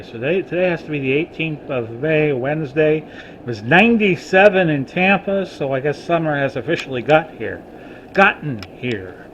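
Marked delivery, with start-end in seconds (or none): none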